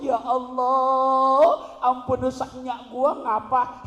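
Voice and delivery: high, loud, drawn-out Ya Allah; hammed-up granny prayer. Preacher mockingly continues the granny's prayer, loud and slow. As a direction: stretch the Allah out long and high. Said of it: "Dalam hati saya nerusin".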